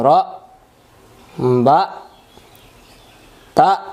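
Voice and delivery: র, বা, তা each punched and cut short; hamza push. Shown as a demonstration. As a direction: separate loud syllables র, বা, তা with glottal stops. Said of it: "তাহলে এখানে উচ্চারণ এমন হবে". (র, বা, তা ধাক্কা দিয়ে পড়া)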